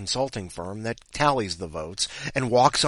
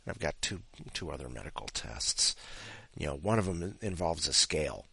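usual speaking voice

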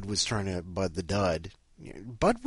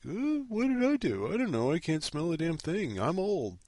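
high-pitched mock cat voice; imitated old cat; 8 semitones higher than usual. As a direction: play a confused, whiny old pet. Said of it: "He's like".

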